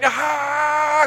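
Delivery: high, loud cry of pain; imitating his small son screaming when the pain hits; child's pain scream. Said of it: "he screams out in pain".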